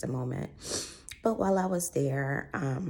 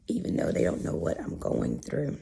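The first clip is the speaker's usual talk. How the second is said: voice cutting out; 9 semitones lower than usual. Real, not acted. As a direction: voice breaking into a hoarse whisper. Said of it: "my voice is trying to go in and out".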